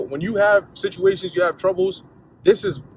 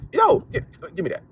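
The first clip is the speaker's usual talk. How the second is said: dismissive parent; faster than usual. Retold or imitated. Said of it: "But you looking at it like".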